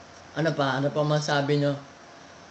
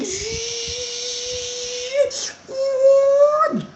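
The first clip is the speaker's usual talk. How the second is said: high laugh. Real, not acted. Laughs after attack.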